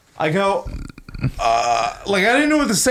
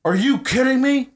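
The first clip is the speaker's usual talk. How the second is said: retold yelling; 9 semitones higher than usual. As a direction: imitate an outraged customer, pitched up. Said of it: "This guy starts yelling".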